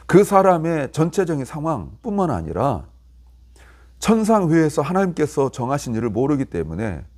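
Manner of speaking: ordinary talk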